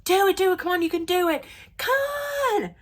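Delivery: high, urgent re-enacted cheer. Re-enacting a fan cheering on the Yankee batter, high and urgent. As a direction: egg the batter on. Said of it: "I was cheering for him".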